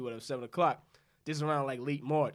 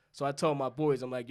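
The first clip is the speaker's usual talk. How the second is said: ordinary delivery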